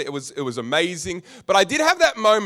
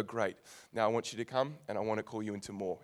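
gentle God voice; 6 semitones lower, 11 dB quieter than usual. Pretend.

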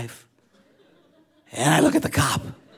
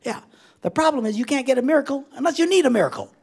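ordinary delivery

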